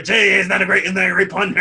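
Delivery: loud, high mock enthusiasm; mock delight. Co-host jeers at the dentist wrestler's name, high mock-delighted voice. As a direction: gush over a bad pun.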